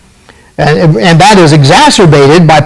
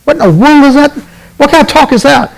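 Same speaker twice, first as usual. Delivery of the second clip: 1 incredulous outburst; 10 semitones higher, faster than usual. Real, not acted. Host scoffing at opponent's phrase.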